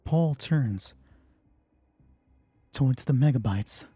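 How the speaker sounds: usual speaking voice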